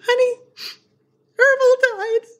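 mock tearful wail, very high; imitated sobbing, squeezed out in halting bursts; barely getting words out. Author breaks a character's death to her husband, tearfully hammed-up. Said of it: "I can barely get the words out".

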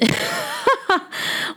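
airy, high falling giggle; short unvoiced sheepish laugh; breathy embarrassed laugh. Real, not acted. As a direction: laugh off the awkward topic.

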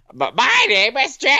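high, silly imitation of his wife; Jamie impression. Husband imitating his wife introducing herself, teasing high voice. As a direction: put on a high mocking wife voice. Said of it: "whenever I'm imitating Jamie, where I do the voice".

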